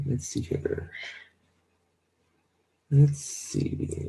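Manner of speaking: half-whispered thinking aloud; whispered to self. Real, not acted. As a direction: whisper it to yourself. Collecting self after crying.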